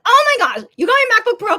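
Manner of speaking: a sister stunned by her brother's expensive present, excited; excited gasp. Retold or imitated. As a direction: gush over the gift. Retelling shock at pricey gift.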